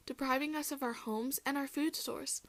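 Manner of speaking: usual speaking voice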